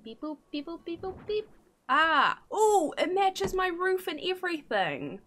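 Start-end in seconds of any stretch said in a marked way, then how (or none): 0.0-1.5 high chirpy beep boops; beep boop noises. Pretend. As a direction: make little robot beeps. Imitating game sound.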